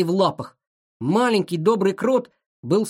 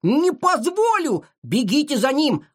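high-pitched indignant command; enraged; 9 semitones higher than usual. A narrator's reading. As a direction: forbid it and send them off in fury. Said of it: "рассвирепел Шиша". (яростный приказ высоким голосом)

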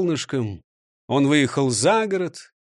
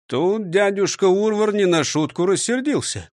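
ordinary delivery, nothing marked

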